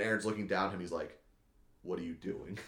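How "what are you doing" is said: low, soft acted menace; quiet confrontation. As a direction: say it down at someone kneeling. Son coldly confronting kneeling father.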